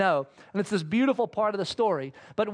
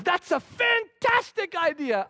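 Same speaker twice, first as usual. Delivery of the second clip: delighted king; 9 semitones higher, slower than usual. Retold or imitated.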